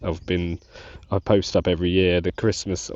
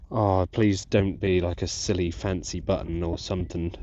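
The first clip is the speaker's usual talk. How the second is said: usual speaking voice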